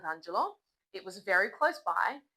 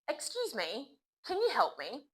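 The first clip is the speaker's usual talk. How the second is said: polite, raised opening to a stranger; polite question; 6 semitones higher, 3 dB quieter than usual. Shown as a demonstration. Teacher models stopping a stranger, polite and raised. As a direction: ask a stranger for help politely. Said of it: "The first one".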